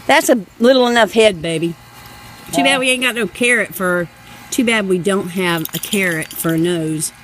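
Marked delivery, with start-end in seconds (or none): none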